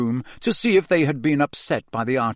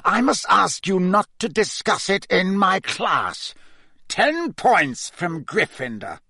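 higher, cold, drawn-out reprimand; icy voice; 6 semitones higher than usual. A narrator's reading. Snape reprimands the students in class, icy voice. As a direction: pronounce the penalty slowly with icy disdain. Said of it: "said an icy voice".

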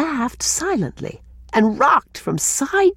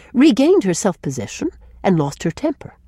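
ordinary delivery, nothing marked

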